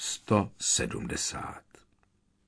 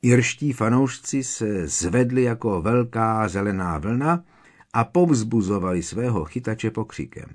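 ordinary delivery

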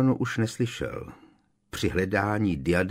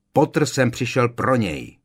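loud, higher irritated shout; raised, irritated insistence; exasperated yell; 4 semitones higher, 6 dB louder than usual. A narrator's reading. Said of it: "rozkřikl se Malfoy".

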